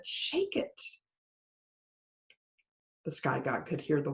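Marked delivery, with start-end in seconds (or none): 0.0-0.8 breathy grinning whisper, high and secretive; sly whisper. Acted. Anansi to the sky god, a grinning sly whisper. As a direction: hush it like a trickster sharing a secret. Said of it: "Anansi grinned and said".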